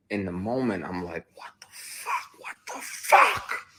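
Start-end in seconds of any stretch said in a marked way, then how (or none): 1.3-3.7 drawn-out whisper building to a forceful rasp; whispered outburst, retold; stunned whisper. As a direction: whisper it slowly in disbelief, then push the last one. Retold stunned reaction in the moment.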